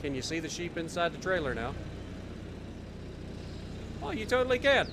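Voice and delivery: usual speaking voice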